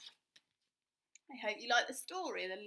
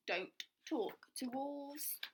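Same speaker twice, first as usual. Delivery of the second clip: ordinary delivery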